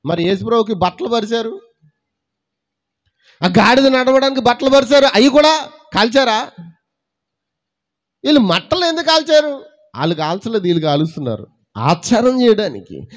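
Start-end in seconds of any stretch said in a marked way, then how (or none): 3.4-9.6 high, loud, ringing preacher's cry; full-force preaching. Acted. Preacher lifts his voice loudly to the congregation. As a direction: proclaim it loudly to the church.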